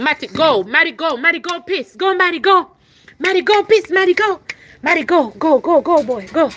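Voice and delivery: loud, high repeated commands; shouting at the dog to go pee; calling the dog. Real, not acted. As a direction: keep urging the dog out loudly.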